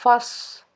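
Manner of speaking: फस with a heavy, full s that whistles; फस, emphatic Arabic saad at the end; full-mouthed saad. Shown as a demonstration. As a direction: thicken the s of फस, letting it whistle. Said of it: "इसको पुर पढ़ना है".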